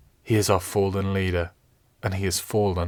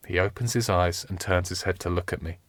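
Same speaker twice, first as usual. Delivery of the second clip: normal talking voice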